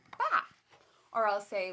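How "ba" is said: mock sheep bleat. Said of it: "she'll say".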